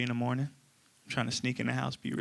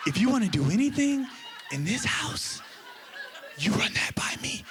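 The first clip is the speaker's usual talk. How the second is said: mock whisper; 8 semitones higher than usual. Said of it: "Why are you whispering?".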